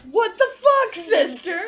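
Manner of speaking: high, loud shocked yell; outraged yell. Retold or imitated. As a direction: yell it in disbelief. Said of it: "He goes".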